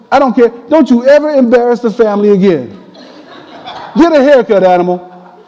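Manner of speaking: high, mock-stern fatherly reproach; scolding dad. Dad phoning his long-haired son, scolding. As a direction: play an exasperated father telling off his boy.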